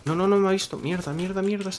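grito agudo de pánico. Real, not acted. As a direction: agudo y fuerte, en pleno susto. Enemy nearly spots him. (grito de pánico)

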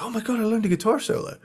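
high, fast thrilled outburst; excited. Retold or imitated. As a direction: gush like a proud teenager. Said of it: "I was like".

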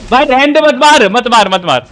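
frantic calling out; urgent pleading. Real, not acted. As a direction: beg a teammate to hold fire. Begging teammate not to shoot.

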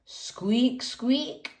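high, rising little squeaks; two high upturned mouse peeps. Acted. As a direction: squeak like mice.

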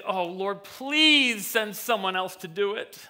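hammed-up whine, "please" drawn out high; pleading Moses. Moses begging God to send someone else, pleading in a mock whine. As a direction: beg comically to be let off. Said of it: "the last thing that Moses said".

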